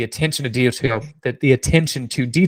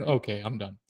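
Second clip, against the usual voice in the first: ordinary talk, nothing marked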